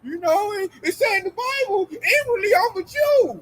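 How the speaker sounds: high, whiny put-on protest; mock denial. Imitating an Edomite denying it all, high mocking whine. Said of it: "their next thing is".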